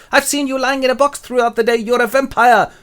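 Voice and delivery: high, loud put-on outrage; accusing Harker.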